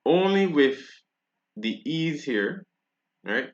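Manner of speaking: usual speaking voice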